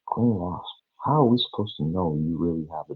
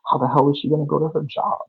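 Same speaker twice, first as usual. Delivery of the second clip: angry shout; 12 semitones higher, 8 dB louder, faster than usual. Real, not acted. Heated rant climax.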